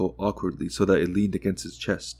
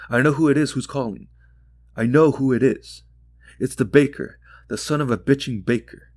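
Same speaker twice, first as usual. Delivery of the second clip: angry realisation; 5 semitones higher, 3 dB louder than usual. Acted. Realising the baker is calling.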